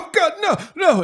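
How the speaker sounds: high, loud panicked shout; panicked cry. Acted. Jack lunging for the falling lantern, panicked high shout. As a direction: cry out as it falls.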